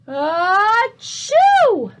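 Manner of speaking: a loud, high bursting achoo; big sneeze. Acted. Sleeping bear sneezing loudly from a pepper fleck. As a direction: sneeze it out huge.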